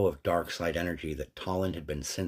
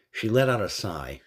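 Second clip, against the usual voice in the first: usual speaking voice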